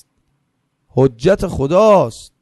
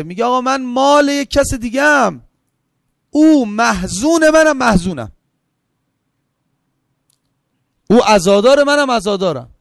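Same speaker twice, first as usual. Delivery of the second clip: higher, building fervent sermon voice; impassioned preaching; 6 semitones higher, 3 dB louder than usual. Acted. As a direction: lift the pitch and preach it like a climax of mourning. Preacher rising to mourning peak. (وعظ پرشور با صدای بالاتر)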